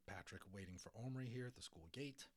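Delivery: ordinary delivery, nothing marked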